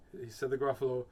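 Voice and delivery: usual speaking voice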